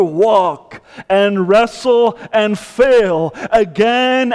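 full-force sermon cry; impassioned preaching. Acted. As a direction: plead for help like the trapped man.

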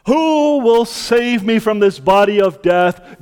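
full-force pulpit voice; fervent preaching. Acted. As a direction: preach the power of Christ.

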